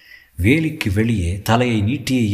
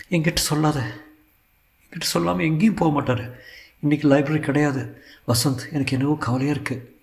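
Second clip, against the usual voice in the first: higher, softer worried voice; anxious girl, softer; 5 semitones higher, 4 dB quieter than usual. Acted. Iniya insists her father never leaves without telling, soft worried voice. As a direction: fret quietly, almost to herself.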